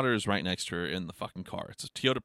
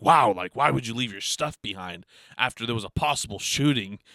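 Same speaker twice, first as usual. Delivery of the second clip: 1 mock shocked gossip; 7 dB louder than usual. Mimics what mom expects him to say.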